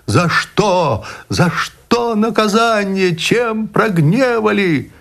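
высоко, медленно, с надрывом; молит небо, срываясь вверх; отчаянная мольба. Acted. (мужик в отчаянии взывает к Богу)

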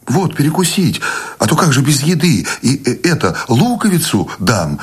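high, halting offer; flustered fussing. A narrator's reading. Wife packing food for the road, flustered fussing.

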